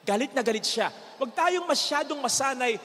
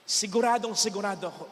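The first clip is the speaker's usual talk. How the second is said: usual speaking voice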